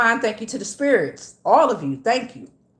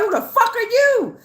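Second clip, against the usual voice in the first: spirit's demand; 13 semitones higher than usual. Retold or imitated. Spirit confronting the reader, higher mock outrage. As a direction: play the spirit snapping back at her. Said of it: "And they was like".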